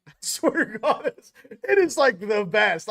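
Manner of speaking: bursting with giddy laughter; laughing excitement. Real, not acted.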